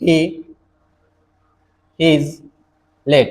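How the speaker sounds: he is late spoken very slowly with long gaps; slow dictation. Shown as a demonstration. Teacher slowly reads an example sentence aloud to students. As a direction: read the sentence out word by word. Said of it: "थोड़ा सा समझने की कोशिश करना".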